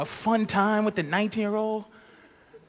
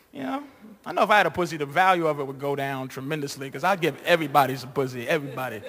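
ordinary delivery

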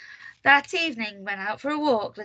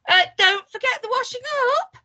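high, loud sing-out order; shrieky nagging reminder; bossy cry; 13 semitones higher, 5 dB louder than usual. A narrator's reading. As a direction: call it out to nag someone leaving. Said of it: "cried little Miss Bossy".

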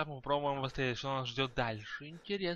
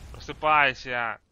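loud slow wake-up call; shouting; 9 dB louder, slower than usual. Real, not acted. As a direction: yell at him to wake up. Calls to the sleeper.

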